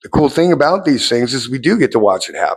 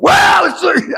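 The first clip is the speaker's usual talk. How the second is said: Kai's voice. Acted. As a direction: bark it like the beach drunk. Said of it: "Kai, what's the surf report look like?".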